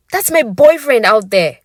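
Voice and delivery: loud high yell; bellowing back. Acted. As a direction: shout him down. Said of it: "I bellow back".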